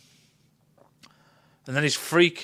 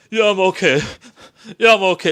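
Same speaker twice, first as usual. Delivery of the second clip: loud, hammed-up fake-fine reply; mock dying crewman; 5 semitones higher, 10 dB louder, faster than usual. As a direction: play the doomed guy insisting he's fine. Mocking dying character's claim.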